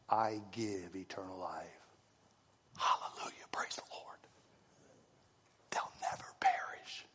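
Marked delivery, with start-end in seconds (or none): 2.8-7.1 hushed, breathy whisper of praise. Real, not acted. Preacher whispering praise to the congregation. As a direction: drop to a reverent whisper.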